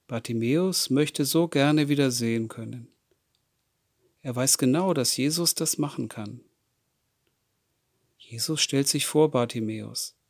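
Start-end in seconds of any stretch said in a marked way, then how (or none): none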